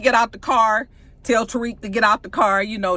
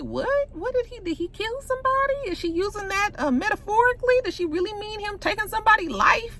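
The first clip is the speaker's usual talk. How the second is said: shocked confusion; 11 semitones higher than usual. Retold or imitated. Reacting to the show's murder line, high and stunned. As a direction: gasp out the question in disbelief. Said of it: "And I was like".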